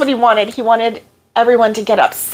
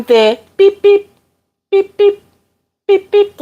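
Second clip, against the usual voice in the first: beep imitation; 9 semitones higher, 3 dB louder than usual. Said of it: "like that".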